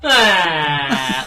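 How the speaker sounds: long, loud mock wail; imitated yell. Imitating the comedian's sketch ending for friends, mock yell. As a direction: let out a drawn-out cartoon scream. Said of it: "and he goes".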